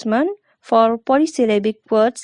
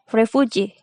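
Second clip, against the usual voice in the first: refugee stressed on the final GEE; primary stress on last syllable. Shown as a demonstration. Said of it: "primary stress is on G".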